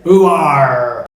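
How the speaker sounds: a rural Cornish grunt of a greeting; Cornish accent. Shown as a demonstration. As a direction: say it like a Cornish farmer. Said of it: "people from Cornwall will say".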